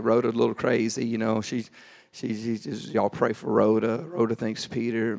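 low, hushed, patronising murmur; condescending aside. Retold or imitated. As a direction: confide softly that she's not all there. Praying people quietly dismiss Rhoda as crazy.

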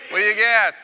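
loud, high rallying shout; cheer yell. Pretend. Speaker ending a football cheer with the crowd, yelling. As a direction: shout the payoff question to the crowd. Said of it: "I'm gonna yell something out".

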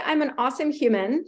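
ordinary talk, nothing marked

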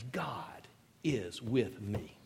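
God is with me said soft and slow; tender personal assurance; hushed confession. Shown as a demonstration. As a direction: breathe it as a quiet truth.

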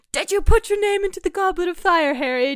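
high, loud theatrical interrogation; hammed-up urgent shout; mock frantic demand. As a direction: burst out in exaggerated alarm. Acts out frantic film Dumbledore line.

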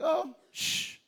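put-on high, soft hush to a child; gentle parent shush. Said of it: "you're like".

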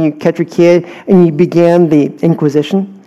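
ordinary delivery

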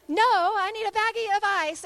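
high, loud, insistent objection; exasperated retold protest. Injured son rejecting the dumped ice, insistent. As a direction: imitate a boy loudly correcting his mother.

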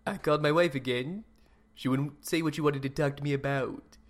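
usual speaking voice

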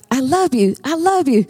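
high, sing-song mama bird voice. Acted. Mama bird reassuring her babies, high and sing-song. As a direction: coo it like a loving mother bird.

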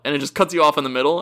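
ordinary delivery

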